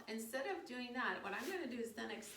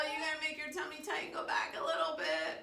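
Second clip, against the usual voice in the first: playful excitement; 4 semitones higher, 7 dB louder than usual. Pretend. Yoga bus drives up hill.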